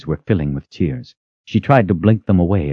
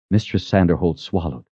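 usual speaking voice

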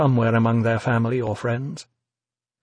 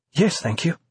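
eager; 5 semitones higher than usual. A narrator's reading. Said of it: "she said eagerly".

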